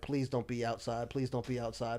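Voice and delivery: low, soft muttered plea; quiet pleading. Retold or imitated. Man retelling his walk out of the stall, quiet pleading. Retold anxious pleading to himself.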